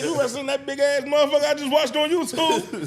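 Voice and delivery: mock disbelief. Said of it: "he said".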